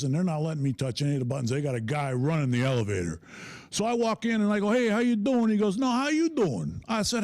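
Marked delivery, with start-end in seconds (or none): 4.7-5.5 high, loud New York greeting; hammed-up New York hello; New York accent. Acted New York elevator greeting. 5.8-6.8 high, drawn-out New York comeback; hammed-up New York retort; New York accent. Elevator man returns the catchphrase.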